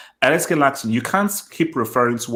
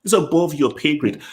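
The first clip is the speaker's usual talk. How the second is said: ordinary talk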